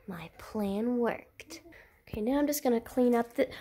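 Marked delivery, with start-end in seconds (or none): 0.1-1.6 slow sneaky whisper. Pretend. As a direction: whisper the gloat like a little schemer. Sneaky child gloats.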